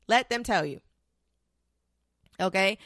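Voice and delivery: usual speaking voice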